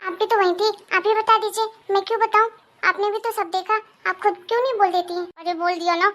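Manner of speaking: usual speaking voice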